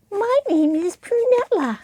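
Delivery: high prim puppet voice; a fussy lady puppet announcing herself; Prunella voice. Shown as a demonstration. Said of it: "make the voice different from their own".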